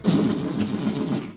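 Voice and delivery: sputtering beat-up car noise; imitated car sputter. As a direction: make the noise of a junker pulling up. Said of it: "That's how it sounds when it pulls up".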